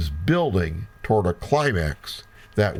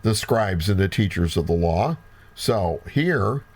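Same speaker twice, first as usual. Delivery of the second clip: ordinary delivery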